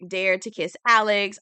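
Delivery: normal talking voice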